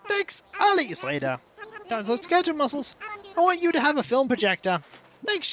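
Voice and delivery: high, bright-eyed cartoon villager; chirpy villager voice. Streamer voicing a game villager, high and chirpy. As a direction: read it like an eager little game character. Reading villager dialogue aloud.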